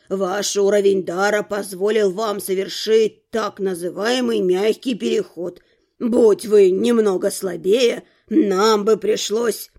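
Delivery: put-on low, measured old man's voice; old rector. A narrator's reading. Rector explains how mages are recruited, measured old man. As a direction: explain it slowly in a deep register.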